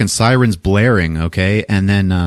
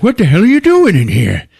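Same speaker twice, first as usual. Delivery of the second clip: loud, high indignant demand; hammed-up exasperated shouting; mock angry yell; 10 semitones higher, 5 dB louder than usual. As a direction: act out an outraged confrontation. Retold bathroom quarrel, after slap.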